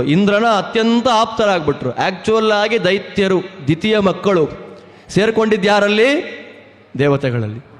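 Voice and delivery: ordinary talk, nothing marked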